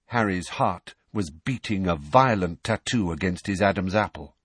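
ordinary delivery

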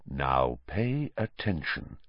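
low, soft and slow; quiet, deliberate command; very low and clear. A narrator's reading. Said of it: "speaking very low and very clearly".

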